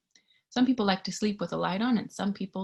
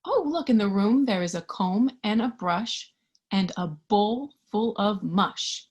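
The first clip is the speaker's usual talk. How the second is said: ordinary delivery, nothing marked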